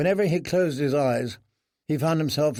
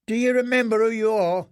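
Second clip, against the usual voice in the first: put-on taunting clever boy; 9 semitones higher than usual. Clever boy taunting the prisoner, high pointed question. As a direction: needle the prisoner about his name.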